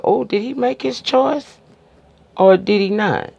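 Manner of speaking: high, teasing rhetorical questions; mock suspense. Reviewer teasing the outcome to viewers, mock suspense.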